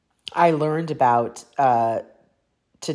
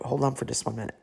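ordinary talk

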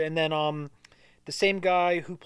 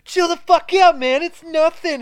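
much higher, loud wigging-out shout; freaked-out yell; 13 semitones higher, 8 dB louder, slower than usual. Retold or imitated. The Chili Pepper guy wigs out at the raid, in a high loud shout. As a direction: yell it like he's losing it. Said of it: "He's like".

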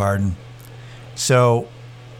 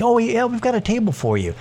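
lightly imitated, higher-pitched host; mock-obliging host in a raised voice; restaurant host; 11 semitones higher, faster than usual. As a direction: put on an eager host voice. Imitating restaurant host seating them.

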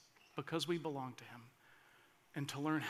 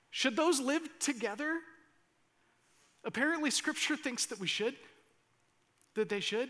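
ordinary talk

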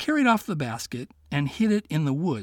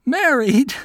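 high, loud incredulous married; can't believe the idea; surprised; 10 semitones higher, 8 dB louder than usual. Acted. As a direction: Miko startled at the fox's suggestion. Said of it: "Miko repeated".

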